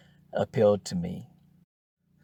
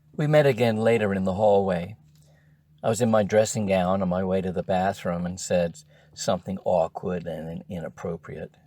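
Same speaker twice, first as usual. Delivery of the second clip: usual speaking voice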